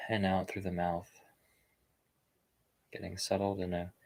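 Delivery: unhurried meditation guidance with long pauses; guided meditation. Real, not acted. Meditation fellow settling online listeners into posture and breath, calm guided-meditation delivery. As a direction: ease listeners into stillness, leaving space after each phrase.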